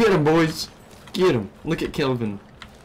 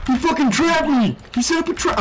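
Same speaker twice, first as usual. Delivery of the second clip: shocked outburst; 10 semitones higher than usual. Real, not acted. Caught in a bush trap.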